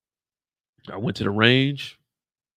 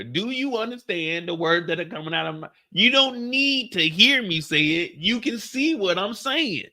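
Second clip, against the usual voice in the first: high, stretched-out comic impression; Chris Tucker; 7 semitones higher than usual. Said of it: "Ty understood Chris Tucker".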